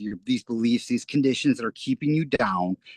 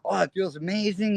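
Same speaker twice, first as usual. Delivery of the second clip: higher, brighter thrilled voice; excited; 7 semitones higher than usual. Retold or imitated. Recalling childhood joy to the host, excited and higher. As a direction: gush like a kid with new video games. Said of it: "I'm like".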